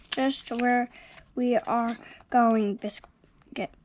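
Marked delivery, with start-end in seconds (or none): none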